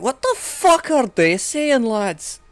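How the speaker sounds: high, loud, slow Glaswegian rant; a baffled Glaswegian shouting to his lads; Glaswegian accent. Retold or imitated. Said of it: "up towards Glasgow".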